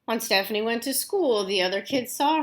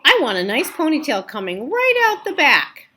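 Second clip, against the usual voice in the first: put-on eager child; 6 semitones higher, 9 dB louder than usual. Child voiced demanding ponytail.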